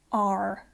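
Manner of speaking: "R" with the r sounded, as in the West Country; rhotic R. Shown as a demonstration. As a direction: say "R" as arr, r held. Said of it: "We're using that rhotic".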